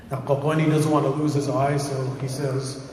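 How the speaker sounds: plain reading voice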